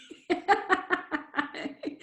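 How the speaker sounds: high, breathless laugh through tears; a laugh that catches on emotion; emotional laugh fighting off tears; teary laugh. Real, not acted. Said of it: "This stuff makes me emotional".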